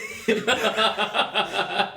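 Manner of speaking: loud laughing fit; burst of laughter. Real, not acted. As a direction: crack up loudly. Laughing at own mistake.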